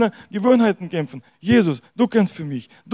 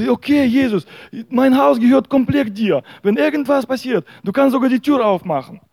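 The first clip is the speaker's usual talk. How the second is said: eager surrender; 6 semitones higher, 3 dB louder than usual. Acted. Man eagerly hands house to Jesus.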